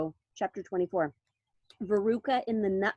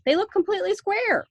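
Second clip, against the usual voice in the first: put-on bratty girl; 9 semitones higher, 5 dB louder than usual. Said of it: "said Veruca Salt".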